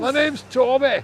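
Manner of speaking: high, slow comic Scottish voice; mock Scottish accent. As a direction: draw out a hammed-up Scottish introduction. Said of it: "Is that your Scottish accent?".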